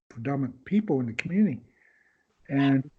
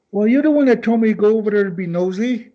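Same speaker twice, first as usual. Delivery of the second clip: playful retort; 6 semitones higher, 6 dB louder, faster than usual. Retold or imitated. Teasing retort to friend Kenny.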